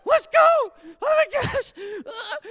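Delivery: very high, loud shriek; hammed-up panicked scream; frantic shout. Sprinting with instrument.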